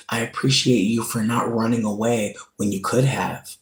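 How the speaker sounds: usual speaking voice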